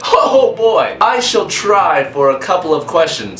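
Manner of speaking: light put-on English accent; a touch of posh English accent throughout. Answering fan questions in a mock English accent. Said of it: "try and do a video entirely with an English accent".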